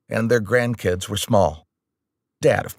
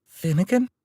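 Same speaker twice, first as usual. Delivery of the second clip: higher, questioning woman's voice; a distraught mother unsure who answered; 9 semitones higher than usual. Acted. Distraught mother calling the boy on the phone, wavering. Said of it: "It was a female voice".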